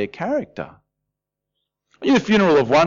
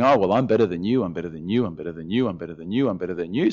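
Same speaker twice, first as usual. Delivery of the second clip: softer, smug self-satisfied chant; smug sing-song; 8 semitones lower, 5 dB quieter, faster than usual. Retold or imitated. Preacher mimics smug man judging others, sing-song. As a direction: gloat over everyone around you.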